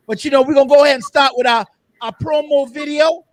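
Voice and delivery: announcer hype. Acted.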